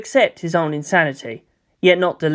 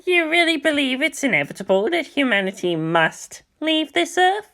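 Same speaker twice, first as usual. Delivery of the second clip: high, gentle questioning elder; an amused old lama probing the boy; a lama's voice rising into a doubting question; 10 semitones higher than usual. Acted.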